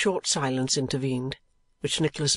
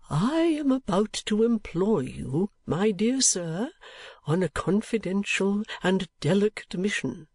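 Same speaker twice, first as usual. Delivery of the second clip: put-on higher-pitched, deliberate old gentleman; kindly old gentleman, confiding; 7 semitones higher than usual. Old gentleman employing Nicholas on a mission, confiding. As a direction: confide a delicate errand with care.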